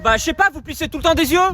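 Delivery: usual speaking voice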